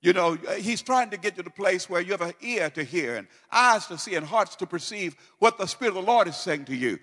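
normal talking voice

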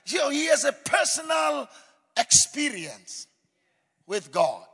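loud, high, ringing sermon delivery; booming pulpit voice; full-force preaching. Acted. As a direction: preach it out over the congregation.

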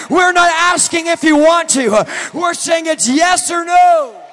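loud, high, slowing preacher's shout; a sermon climax rising to a roar; full-force preaching. Acted. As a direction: drive it home like a revival preacher. Long altar-call climax.

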